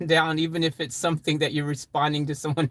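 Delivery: ordinary talk, nothing marked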